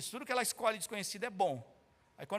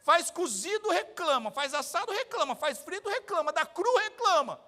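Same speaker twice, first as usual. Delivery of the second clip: higher, louder preaching rhythm; 4 semitones higher, 3 dB louder than usual. Acted. Preacher lists dishes the congregation still complains about, rising rhythm. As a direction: drive home each complaint.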